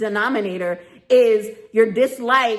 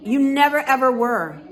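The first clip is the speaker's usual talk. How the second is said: ordinary talk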